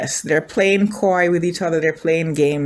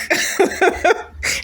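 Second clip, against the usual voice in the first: high, helpless laugh; high giggle; 11 semitones higher than usual. Real, not acted. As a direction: burst into a high-pitched giggle. Laughs at actors being cold.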